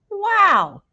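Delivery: loud high delighted wow; big excited shout of wow. A narrator's reading. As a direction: burst out thrilled. Marty excited about island.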